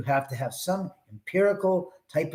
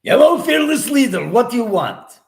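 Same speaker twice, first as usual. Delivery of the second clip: higher, louder cartoon spy woman greeting her boss; Natasha voice; 8 semitones higher, 8 dB louder than usual. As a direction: squeal a bright hello to the chief.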